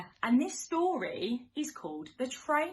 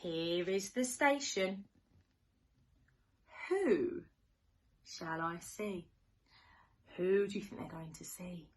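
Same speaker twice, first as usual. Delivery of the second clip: sing-song storybook rhyme; reading to children; 5 dB louder, slower than usual. Acted. Teacher reading to little ones, sing-song and playful. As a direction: read it like a bedtime picture book. Reads rhyming picture book.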